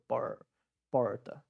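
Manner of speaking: por, porta with the R articulated deep in the throat; throaty paulista R. Shown as a demonstration. Teacher isolating the Paulista R for students, throaty retroflex R. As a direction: curl and hold the r in porta, English-style. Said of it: "esse R articulado bem na garganta".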